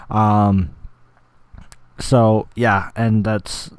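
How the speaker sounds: ordinary delivery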